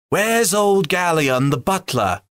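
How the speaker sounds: high, slow call for the butler; lightly voiced elf looking for the butler; an elf asks the others where the butler is, calling high. A narrator's reading. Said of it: "said one".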